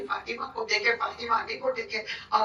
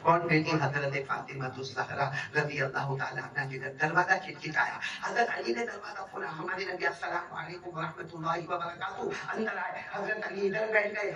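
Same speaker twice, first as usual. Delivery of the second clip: ordinary talk, nothing marked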